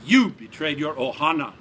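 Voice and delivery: loud, hard accusation; angry accusation. A narrator's reading. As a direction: hurl the betrayal at him. Giant confronts captive.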